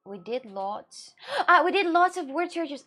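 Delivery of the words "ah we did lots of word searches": excited realization. Real, not acted. Realising the right word.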